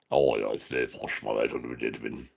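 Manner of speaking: low, soft mock-posh accent. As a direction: put on a very posh accent, hushed and deep. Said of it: "you have a very posh accent".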